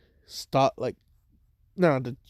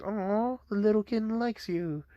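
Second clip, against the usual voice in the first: higher, softer cooing over a kitten; cooing worker; 8 semitones higher, 5 dB quieter than usual. Retold or imitated. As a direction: coo gently like talking to a baby animal.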